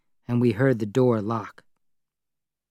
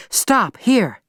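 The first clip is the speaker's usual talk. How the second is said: short, high command to the driver; ordering; 10 semitones higher, 3 dB louder than usual. Acted. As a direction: give the order, sharp and sure. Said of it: "she ordered the driver".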